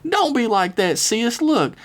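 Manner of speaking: wide-eyed, pleading and high; high southern girl voice. Acted. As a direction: beg her with a high sweet voice. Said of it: "Lizzie said with her eyes wide".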